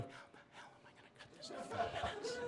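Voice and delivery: fast, panicked whisper; frantic inner whisper. Retold or imitated. Comic panicking in his head before going on, fast whisper. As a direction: hiss it fast to yourself in a panic. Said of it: "inside my head, I'm going".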